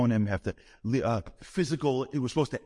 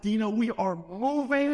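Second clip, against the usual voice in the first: slow, higher, thrilled declaration; giddy announcement; 8 semitones higher than usual. Retold or imitated. Calling his wife with giddy news, slow and higher. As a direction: gleefully break big news. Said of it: "I said".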